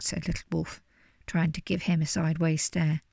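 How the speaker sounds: ordinary delivery, nothing marked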